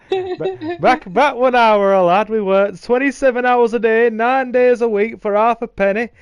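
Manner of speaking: hammed-up northern English old-timer; mock northern. Telling friends absurd childhood hardships, mock northern accent. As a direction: grumble proudly in a broad put-on northern accent. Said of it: "I have all these voices and accents".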